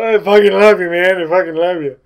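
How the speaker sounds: put-on loud, sappy drunk declaring love; drunk crying. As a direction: gush it out like a weepy drunk. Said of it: "like a sad, crying drunk".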